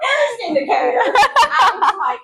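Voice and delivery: usual speaking voice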